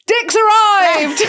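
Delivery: slow high shout, crying out the news; shouted announcement. Retold or imitated. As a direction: draw it out like a big announcement. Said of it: "Colby just went".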